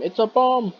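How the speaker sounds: a film scream acted out at talking volume; half-hearted high yelp of warning; mock scream. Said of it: "he was meant to scream".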